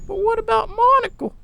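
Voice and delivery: put-on high, thin old woman pleading about the dog; frail worried wife calling out; anxious old Molly. Wife worries about their dog, high and anxious.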